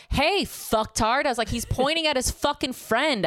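high, stretched indignant call; retold angry call-out. Customer calling out rude bartender, indignant. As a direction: call him out, drawing it out.

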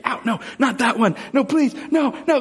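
high-pitched pleading cry of a tree being cut. Pretend. Pastor imagines being the pruned tree, high pleading cry. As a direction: beg like a branch under the shears, voice up high. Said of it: "imagining, you know, being that tree".

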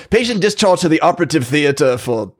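hammed-up British accent, amused at yourself; mock British chart-reading. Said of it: "in British accent".

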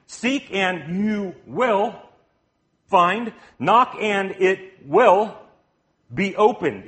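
slow, deliberate verse with "will" drawn out before a pause; emphatic reading. Shown as a demonstration. Preacher showing Jesus's forcible wording, emphatic stress on "will". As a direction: lean on each "will", then pause before what follows. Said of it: "It's emphatic".

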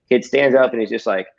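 usual speaking voice

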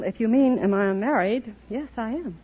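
usual speaking voice

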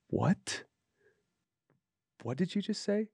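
stunned question jumping high; retold disbelief. Reacting to the officer saying twenty-five to life, high stunned disbelief. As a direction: act out disbelief, pitch leaping up.

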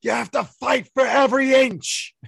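high, loud, fiery coach's pep talk; imitating a roaring locker-room speech; Al Pacino speech. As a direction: fire up the team like a desperate coach. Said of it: "Al Pacino on Any Given Sunday".

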